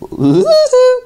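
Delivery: very high staccato cartoon cackle; rat-a-tat woodpecker. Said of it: "Woody Woodpecker's gonna say something".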